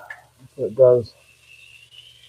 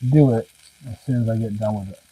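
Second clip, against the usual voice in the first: usual speaking voice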